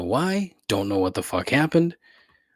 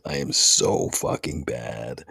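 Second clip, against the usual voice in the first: dejected groan; 11 semitones lower, slower than usual. Real, not acted. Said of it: "Oh, I am so fucking bad at this".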